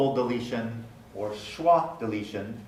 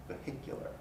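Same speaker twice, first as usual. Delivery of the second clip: whispered vehicular; 16 dB quieter, faster than usual. Shown as a demonstration. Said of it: "realized as part of the pronunciation".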